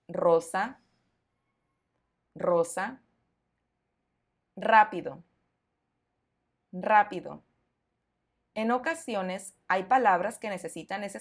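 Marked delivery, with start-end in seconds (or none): 0.1-3.0 "rosa" with a strong, rolled initial R; teacher's model "rosa", R fully trilled. Shown as a demonstration. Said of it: "siempre será fuerte". 4.6-7.4 "rápido" with a strong, rolled initial R; teacher's model "rápido", R fully trilled. Shown as a demonstration. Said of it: "siempre será fuerte".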